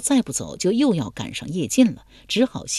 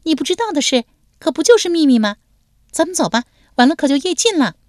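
high, bright giggly voice, louder; merrily pitched-up young lady; cheerful young woman; 11 semitones higher, 6 dB louder, faster than usual. Acted. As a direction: tease him with a grin. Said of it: "第五俊笑嘻嘻地说".

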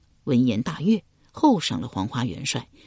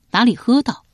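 usual speaking voice

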